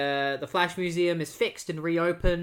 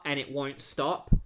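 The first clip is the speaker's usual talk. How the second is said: ordinary delivery, nothing marked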